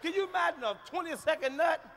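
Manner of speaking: normal talking voice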